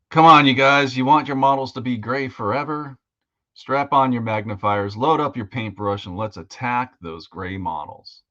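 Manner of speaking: loud drill sergeant rallying cry. Shown as a demonstration. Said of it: "starting the show out by going".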